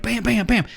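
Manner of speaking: high-pitched spoken gunshot noises; imitated gunfire. Player imitating another player firing at a shredder, mock gunfire. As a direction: mimic rapid shots in a high voice.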